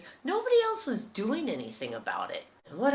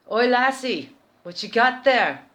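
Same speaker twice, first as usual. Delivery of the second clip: louder Scottish challenge, higher; Scottish bloke; 4 semitones higher, 12 dB louder than usual. Retold or imitated. Scottish club worker catches a thief, loud Scottish call. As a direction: call her out in a Scottish accent. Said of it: "a big Scottish hand".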